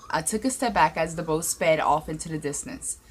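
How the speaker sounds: ordinary delivery, nothing marked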